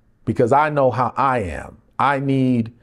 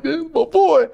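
proud laughing; 3 dB louder than usual. Retold or imitated.

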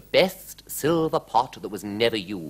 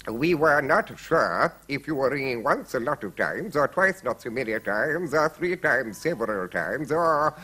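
higher-pitched servant character voice; earnest, bumbling servant; muddled footmen; 4 semitones higher than usual. A narrator's reading. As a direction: explain haplessly as a confused footman. Footmen explain to queen.

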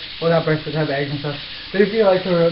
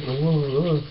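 ordinary delivery

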